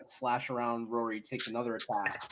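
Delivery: ordinary talk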